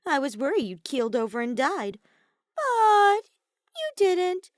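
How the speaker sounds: mock-worried taunt, loud, leaping high. A narrator's reading. Kokichi mocks Kaito for surviving, high teasing squeal.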